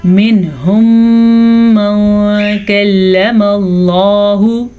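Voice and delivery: Quran recitation. Shown as a demonstration. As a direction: recite with drawn vowels. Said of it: "یہاں پہ غنہ کیا ہے".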